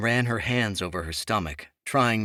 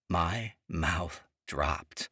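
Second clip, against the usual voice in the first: ordinary delivery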